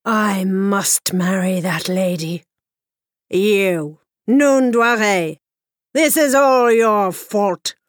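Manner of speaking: commanding king. Acted. King commands and threatens boy.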